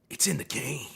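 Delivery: hushed, low game tagline; breathy low slogan read; whispered slogan. Retold or imitated. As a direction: whisper it like an ad tag.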